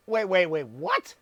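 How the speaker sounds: loud, playful double take; mock disbelief. Preacher reacting to a feeble Bible excuse, mock disbelief. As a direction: cut in loudly, as if you can't believe it.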